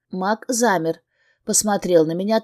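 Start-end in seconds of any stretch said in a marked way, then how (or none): none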